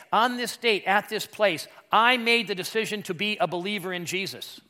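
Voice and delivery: emphatic sermon delivery; impassioned preaching. Acted. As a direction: declare it high and forcefully to the congregation. Preaches at full force.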